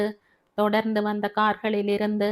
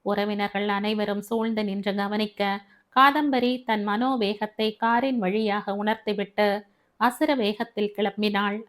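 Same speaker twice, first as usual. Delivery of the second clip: usual speaking voice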